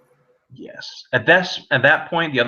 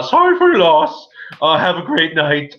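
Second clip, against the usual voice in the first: a nervous priest rushing, a bit higher; hurried priest; 6 semitones higher, 5 dB louder than usual. Acted. Priest rushing condolences at the graveside. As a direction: gabble it out to get away. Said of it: "rest of the eulogy very quickly".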